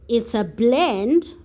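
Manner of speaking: ordinary delivery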